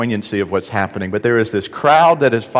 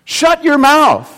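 much higher and louder, slow scolding bark; harsh shout; 14 semitones higher, 5 dB louder, much slower than usual. Retold or imitated. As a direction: silence him at once. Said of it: "what his disciples said to Bartimaeus".

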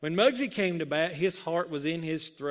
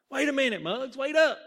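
raised, high-pitched call to a friend; calling after someone; 8 semitones higher, 5 dB louder, faster than usual. A narrator's reading. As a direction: call out to make him wait. Teammate calling after Muggs.

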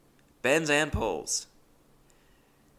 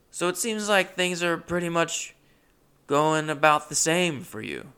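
put-on deflating old friend; 6 semitones higher, 3 dB louder than usual. Quoting Hal's deflating verdict.

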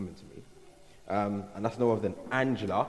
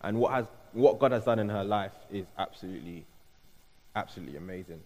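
ordinary delivery

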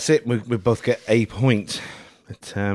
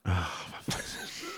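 exasperated whisper; 6 semitones lower, 16 dB quieter than usual. Real, not acted. Offered another chili nut.

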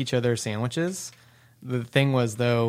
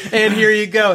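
high, loud playful waiter voice; mock waiter; 10 semitones higher, 11 dB louder than usual. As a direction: serve it up like a cheesy waiter. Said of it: "They'd be like".

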